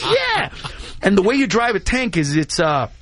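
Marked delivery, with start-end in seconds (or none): 0.0-0.5 much higher excited yeah; all in, bursting; eager yes. Retold or imitated. Carlos accepting the tank ride, high excited yes.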